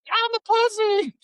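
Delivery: very high, slow, whiny mock recruit voice. Mocking the recruit who repeats the insult, squeaky and slow. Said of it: "And they're like".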